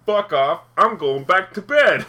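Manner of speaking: slow, loud brush-off, acted out; imagined defiant retort. Student imagining telling off the teacher, mock defiant retort.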